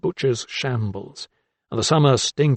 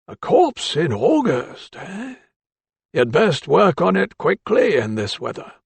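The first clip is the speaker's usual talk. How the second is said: put-on higher, slow, dry voice of an elderly doctor; slow higher old-man voice, probing; 6 semitones higher than usual. A narrator's reading. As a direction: let the old mentor needle him, slowly.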